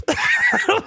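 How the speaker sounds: very high shriek of laughter; helpless squealing laugh. Real, not acted. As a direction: burst out in a squeal. Telling the lube story.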